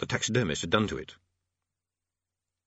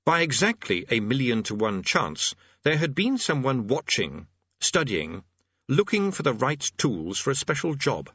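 ordinary delivery

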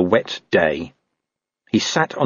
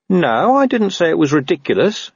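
higher-pitched, wary protest; suspicious dog; 9 semitones higher than usual. A narrator's reading. Dog suspecting he is being tricked, mildly wary. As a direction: correct him with mild suspicion. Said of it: "suddenly full of suspicion".

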